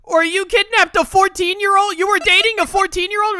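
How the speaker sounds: can't believe what he's hearing; horrified disbelief. Real, not acted. Player grilling the defendant, loud and appalled.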